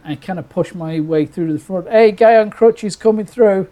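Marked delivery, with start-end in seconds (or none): 1.9-3.7 raised, high call to a crowd. Retold or imitated. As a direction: call your way through, mock urgent. Calling out to get through crowd.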